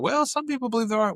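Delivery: high, sing-song dodge of the question; evasive parent. Retold or imitated. Parents dodging kids' Santa question.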